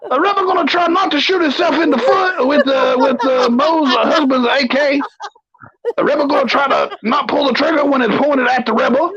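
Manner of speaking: put-on high, blustering old colonel; pompous sputtering military officer. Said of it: "do every top ten in the Colonel voice".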